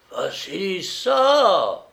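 long, loud, high call; drunken bellow across rooms; sudden shout. A narrator's reading. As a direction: yell her name, stretching the end. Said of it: "cria-t-il tout à coup".

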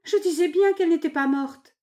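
high, overjoyed exclamation; excited maid's outcry; crying out. A narrator's reading. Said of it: "en s'écriant".

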